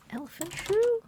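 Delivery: baffled repeat. Real, not acted. Repeating the odd name, baffled.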